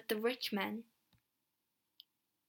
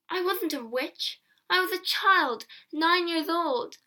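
high, pleading emphasis; indignant protest; 6 semitones higher than usual. A narrator's reading. Child hit and laughed at, protesting. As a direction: protest it with rising pitch.

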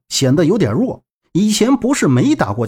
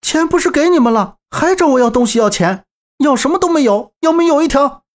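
much higher, indignant outburst; furious; 9 semitones higher than usual. Acted. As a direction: defy the thugs, pitch way up. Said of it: "左阳更是气愤". (气愤地高声顶撞)

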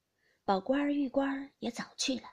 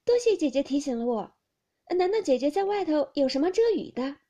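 lifted, louder reply with a touch of amusement; amused reply; 5 semitones higher, 8 dB louder than usual. A narrator's reading. Baoyu thanks the girl in the rain, lightly amused. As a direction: call it out higher, lightly amused. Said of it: "阴笑道".

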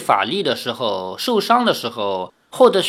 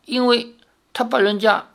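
put-on shy girl voice; 8 semitones higher than usual. A narrator's reading. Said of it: "赵灵儿的声音低不可闻".

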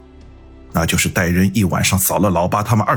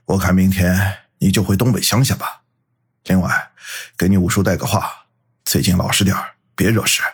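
disappointed; 2 semitones lower than usual. A narrator's reading. Boss sending his cowardly relative home, disappointed. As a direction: let down, with a weary breath. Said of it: "郭春平失望地吐了口气".

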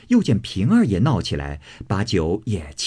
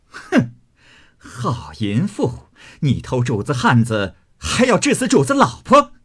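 furious cursing; 4 semitones higher than usual. Acted. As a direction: curse the rival, climbing to a shriek. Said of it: "堵着门站着骂道". (怒骂，越骂越高越响)

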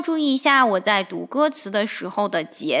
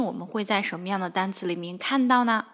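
ordinary talk

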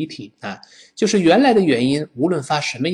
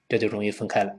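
ordinary delivery

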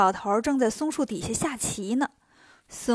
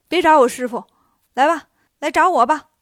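urgent challenge; 8 dB louder than usual. A narrator's reading. Wukong leaps down to save master.

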